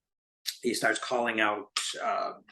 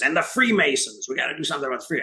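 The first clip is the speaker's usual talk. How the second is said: higher, louder mock rant from the platform; rally speaker ranting; 5 semitones higher, 4 dB louder, faster than usual. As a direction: ham up the speaker calling them out. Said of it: "he says".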